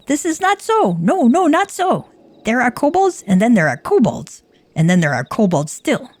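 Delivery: a captured kobold lecturing on kobold kinds; squeaky kobold. Acted. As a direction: explain eagerly in a high little creature voice.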